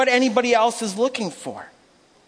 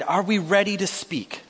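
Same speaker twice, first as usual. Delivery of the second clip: lower, softer, earnest question; jokes aside, serious; 5 semitones lower, 5 dB quieter than usual. Real, not acted. Preacher turning serious after joking with church, quietly earnest. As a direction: settle down and ask sincerely. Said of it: "all jokes aside".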